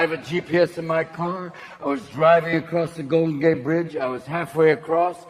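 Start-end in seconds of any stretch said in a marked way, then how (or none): none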